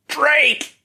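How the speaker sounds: very high, loud scream of the name; a drugged prisoner screaming his brother's name; pained scream. A narrator's reading. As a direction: scream it as if in pain. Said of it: "screamed the name, as if in pain".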